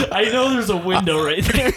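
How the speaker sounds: mock customer line through laughter; laughing at his own retelling; laughing quote. Mocking customer while laughing.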